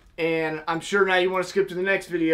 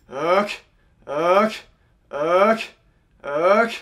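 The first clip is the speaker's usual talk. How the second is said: goose call honk; 5 dB louder, much slower than usual. Shown as a demonstration. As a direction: push hot pressurized air through with tight cheeks. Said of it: "It's gonna be".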